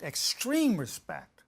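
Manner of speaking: extreme respect, slow, leaning hard on extreme; stress on extreme. Shown as a demonstration. Teaching couples to show extreme respect, slowly stressing extreme. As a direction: drag out extreme, stretching every sound. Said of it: "We're not going respect".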